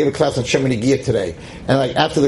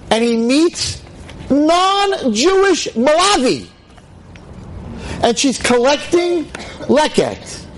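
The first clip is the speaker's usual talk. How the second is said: high, slow, stretched words; high dramatic emphasis; 12 semitones higher, slower than usual. Real, not acted. Preacher describing Boaz meeting Ruth, high and drawn out. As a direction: draw out the wonder.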